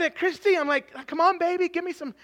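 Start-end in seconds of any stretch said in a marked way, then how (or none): 1.1-2.1 high-pitched wheedle to a wife; mock pleading. Husband asking wife for help with kid's question, mock pleading. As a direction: beg for backup, playfully desperate.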